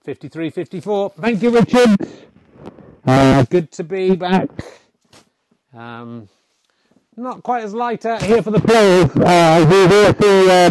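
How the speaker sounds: lively snooker commentary; sports commentator. Acted. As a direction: call the match like a TV commentator.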